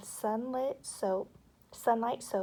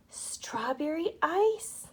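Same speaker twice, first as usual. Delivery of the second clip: slow, higher, delighted; ecstatic; 6 semitones higher, 4 dB louder, slower than usual. A narrator's reading. Michael tasting the medicine, slow and delighted. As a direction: savour it with delight. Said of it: "he said ecstatically".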